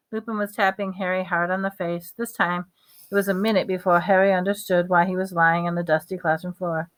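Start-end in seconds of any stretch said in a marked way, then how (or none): none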